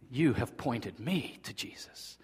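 hushed whispered reply. Retold or imitated. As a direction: breathe it out, barely voiced. Recalling his reply to friend.

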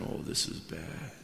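low, quiet, slow groan of misgiving; dismayed aside. Retold or imitated. Pastor rolls his eyes at the boasting, dismayed. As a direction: drop it under your breath, drawn out.

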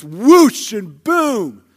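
loud, swooping sound-effect words; imitated train roar. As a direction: make the words mimic a train thundering past. Imitates a passing train's noise.

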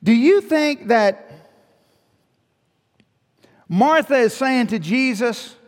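loud, high preaching voice; fired-up sermon delivery; full-force preaching. Acted. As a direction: preach it out to the congregation.

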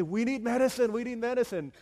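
loud, high mock plea; hammed-up desperate begging of the sick; pleading sick villagers. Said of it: "coughing and wheezing and bleeding".

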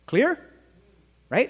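emphatic check. Real, not acted. Preacher checks with congregation.

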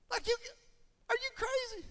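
very high squeaky are you crazy; preacher scolding the grumblers, incredulous. Real, not acted. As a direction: disbelief at their nonsense. Preacher rebukes the complainers.

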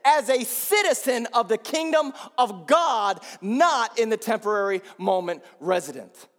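slow, loud, ringing sermon cadence; preaching at full force. Acted. Pastor urging the church to look beyond, preaching at full force. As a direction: lift the congregation with each phrase.

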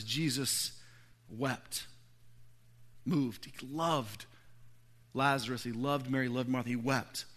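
low, soft, halting telling; hushed solemnity. A narrator's reading. Preacher telling how Jesus wept for Lazarus, hushed and solemn.

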